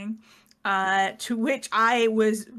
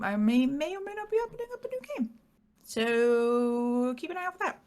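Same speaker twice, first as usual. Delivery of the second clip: coy teaser; 4 semitones higher than usual. Pretend.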